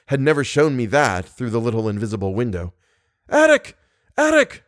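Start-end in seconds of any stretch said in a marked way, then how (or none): 3.3-4.6 high, loud desperate calling of a name; desperate cry. A narrator's reading. Narrator drowning in the trap, crying out to Eric. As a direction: cry out for help across the water. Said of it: "I cried".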